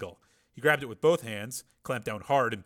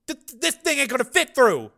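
nervous stammer; 14 semitones higher, 10 dB louder than usual. A narrator's reading.

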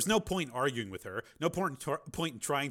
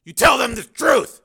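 loud, higher rebuke; angry shout; 13 semitones higher, 19 dB louder than usual. Acted. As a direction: Steele rebuking Janice over lying to the children, angry shout. Steele rebukes ex-wife.

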